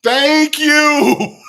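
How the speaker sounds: high, loud, laughing thank-you; vindicated outburst. Real, not acted. Reactor hears someone confirm the African accent, vindicated outburst. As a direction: cry it out in relieved triumph.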